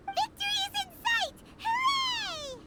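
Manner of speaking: high, bright whoop of victory; excited cheer. Acted. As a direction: cheer like you've already won. Close to base camp, cheering.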